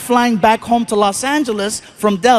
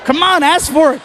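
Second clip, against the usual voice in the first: egging on; 7 semitones higher than usual. Acted. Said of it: "and he goes".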